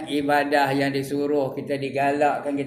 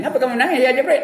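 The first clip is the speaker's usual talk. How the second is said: much higher, louder questioning voice; voicing Jibril; 10 semitones higher, 6 dB louder, much faster than usual. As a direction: ask it as Jibril in a raised voice. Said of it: "Jibril pun tanya".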